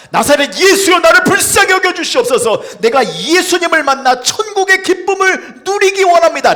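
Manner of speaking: loud, high desperate cry for rescue; desperate shout. Acted. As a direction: plead at full force, as from hell. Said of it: "애절한 표현을 하고 있는 겁니다". (지옥 같은 삶에서 구해 달라는 애절한 외침)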